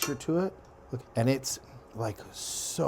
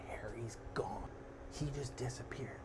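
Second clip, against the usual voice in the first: whispered eerie reveal; softer, whispered twist; spooky whisper; 11 dB quieter than usual. Acted. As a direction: breathe the news like a ghost story.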